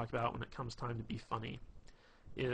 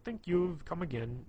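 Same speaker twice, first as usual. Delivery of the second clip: lightly put-on Indian accent; a hint of a cartoon Indian accent; mild mock Indian accent; Habib from The Simpsons; 5 semitones higher than usual. Shown as a demonstration. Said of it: "talk like Habib".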